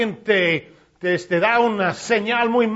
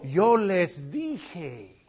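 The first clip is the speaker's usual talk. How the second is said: low, soft and very slow; God's grieved reproach; grave reproach; 5 semitones lower, 7 dB quieter, much slower than usual. Retold or imitated. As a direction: weigh every word sadly. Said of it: "Dios diciendo".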